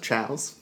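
Chows said as "chows", rhyming with cows; insistent correction. Shown as a demonstration. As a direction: insist it's Chows, not chaos. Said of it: "Actually, it's pronounced Chows".

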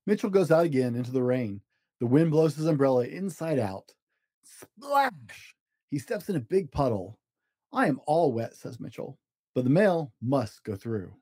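gentle storybook narration with small character voices; reading to children. A narrator's reading. Dad reading a story to his kids, storybook voice. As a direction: read it like a bedtime picture book. Dad reads picture book aloud.

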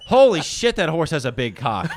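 shouted, high and loud; sudden loud exclamation of shock; retold loud outburst. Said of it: "I literally went".

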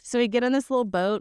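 ordinary talk

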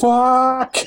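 high, drawn-out shocked curse; swearing in shock. Shown as a demonstration. Host shows the F-bomb in shock, high stretched cry. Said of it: "You could use it in shock".

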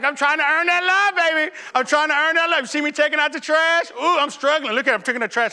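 high, loud hammed-up pleading. Husband shows wife his chores to earn love, pleading loudly. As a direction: show off the effort, comically desperate.

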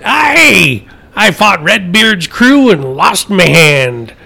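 slow, high hammed-up pirate. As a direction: ham up the old sea dog. Pirate joke, pirate voice.

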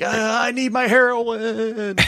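high, slow whiny junkie moan; mock junkie. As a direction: moan it like a desperate addict. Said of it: "he looks like".

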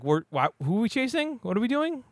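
high, baffled mock cop; confused cop. Backup officers called to the chase, high baffled voice.

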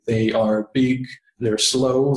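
ordinary delivery

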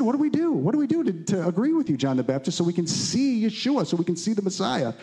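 high-pitched pleading questions, retold; imitated eager crowd. People asking the prophet what to do, high and urgent. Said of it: "They said".

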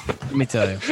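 chuckling through the words; laughing. Real, not acted. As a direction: laugh it out while saying it.

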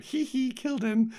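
high-pitched mock giggly voice; squeaky impish giggle impression; giggling possessed girl. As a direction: titter it in falsetto, pleased with the kill. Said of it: "starts giggling".

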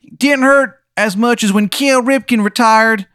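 high, slow, whiny falsetto; dying Steve. Acted. GM voicing Steve's dying complaint about Cal Ripken, high whine. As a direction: keep the squeaky pained moan going.

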